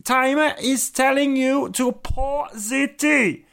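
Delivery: put-on high, loud, heavy German accent; stern German order, slow and emphatic; German waitress. Host imitating the Berlin tea lady, commanding German accent. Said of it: "a lady brought out an egg timer and said".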